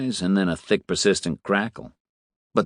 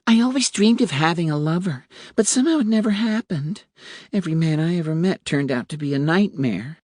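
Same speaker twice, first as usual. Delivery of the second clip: put-on wistful young woman; 9 semitones higher than usual. A narrator's reading. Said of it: "The woman was saying".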